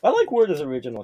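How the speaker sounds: ordinary delivery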